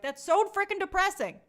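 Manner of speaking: hammed-up despair; mock dismay. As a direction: overplay being bummed out. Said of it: "'cause I was like".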